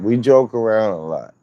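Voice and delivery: ordinary talk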